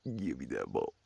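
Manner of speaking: low, growly dog voice; gruff dog voice. Retold or imitated. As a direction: demand it like a stubborn dog. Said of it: "she's like".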